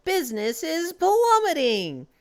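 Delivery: high, dismayed shopkeeper imitation; hammed-up outrage, drawn out at the end; Morris. Manager losing customers at the celebration, theatrical dismay. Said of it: "then Morris shows up".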